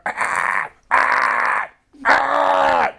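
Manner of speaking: harsh throaty raven caws, climbing to a shout. Retold or imitated. Preacher imitating ravens heard in his yard, in harsh rising caws. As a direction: croak like a raven, rough and grating.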